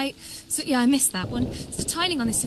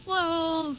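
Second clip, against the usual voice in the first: higher, drawn-out whoa; amazed reaction; 6 semitones higher than usual. Real, not acted. Streamer watching his effect go crazy, amazed. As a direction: marvel at it in one long falling note.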